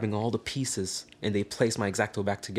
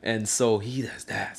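usual speaking voice

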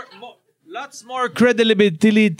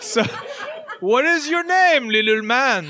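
Santa voice; 5 semitones higher than usual. Acted. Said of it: "I'm gonna try to do the voice now".

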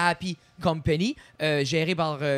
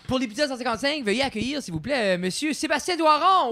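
booming stage introduction; 6 semitones higher than usual. Acted. Formal welcome of the guest.

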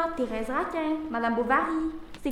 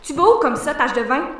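loud challenge down the hall; loud taunting call; 8 dB louder than usual. Acted. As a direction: stop her with a sharp call. Blanche confronts Annette in hallway.

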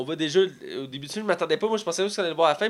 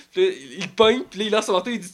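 usual speaking voice

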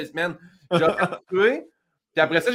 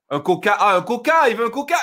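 high-pitched French "ah, un Coca!"; French waiter; 5 semitones higher than usual. Retold or imitated. French waiter finally understands the Coke order, high mock exclamation. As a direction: mimic a French waiter catching on.

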